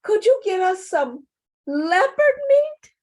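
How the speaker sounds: put-on very high, loud baby-rabbit wailing; mock crying. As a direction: bawl and beg your parents like hungry little ones. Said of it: "the rabbits are crying again".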